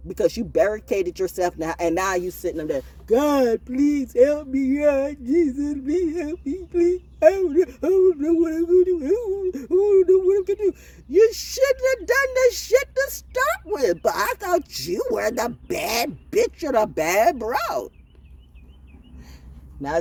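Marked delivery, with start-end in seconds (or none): 3.1-10.7 high, loud, whimpering mock prayer; mock crying plea. Mocking a cornered criminal begging God, high and sobbing. 11.1-17.9 mocking high retort.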